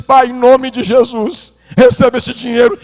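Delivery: son tearfully begs father to take the money; tearful plea. Retold or imitated. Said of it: "E chorando copiosamente".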